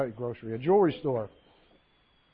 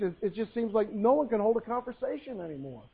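ordinary delivery

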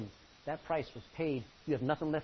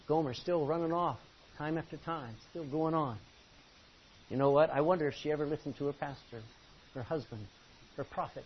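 ordinary talk

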